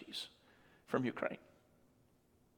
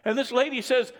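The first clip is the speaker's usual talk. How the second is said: ordinary delivery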